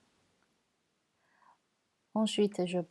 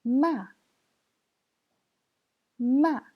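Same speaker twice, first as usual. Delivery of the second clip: "Ma" high and loud, falling fast; fourth tone; 5 semitones higher, 7 dB louder than usual. Shown as a demonstration. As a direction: drop each "Ma" sharply from high. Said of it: "c'est le quatrième ton".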